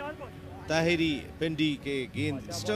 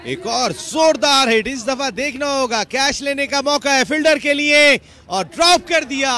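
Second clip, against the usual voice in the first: loud, rising call of the hit; building roar as the ball flies; excited cricket commentary; 7 semitones higher, 12 dB louder than usual. Real, not acted. Live commentary on a dropped catch.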